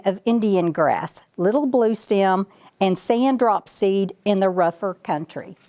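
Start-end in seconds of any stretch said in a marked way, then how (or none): none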